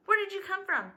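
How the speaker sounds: high, loud accusing question; demanding. A narrator's reading. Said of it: "they demanded".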